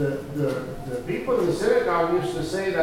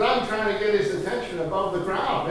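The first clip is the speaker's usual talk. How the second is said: usual speaking voice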